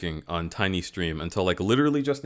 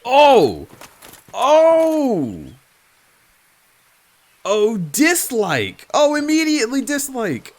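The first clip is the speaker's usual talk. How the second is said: startled shrieks; 14 semitones higher, 9 dB louder, slower than usual. Real, not acted. Enemy appears, startled streamer.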